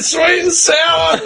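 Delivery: high, slow, put-on voice through laughter; mock quip. Imagining slapping the KFC worker, slow high silly quip. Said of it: "I said".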